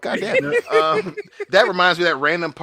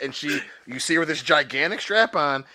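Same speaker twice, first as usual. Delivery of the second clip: ordinary talk, nothing marked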